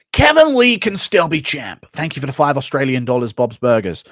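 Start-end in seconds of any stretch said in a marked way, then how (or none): 0.1-1.9 high, loud, slowed mock pundit; squeaky confused pundit call; Chael Sonnen impression. Streamer continuing the Chael Sonnen bit, high and slow. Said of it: "Chael Son and Confusion C T E intro impression".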